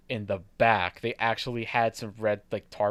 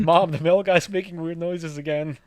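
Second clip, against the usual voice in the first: put-on high, loud whiny child; kid voice; 7 semitones higher, 7 dB louder than usual. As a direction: tattle to mom like a kid. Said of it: "kids in their house looking at me".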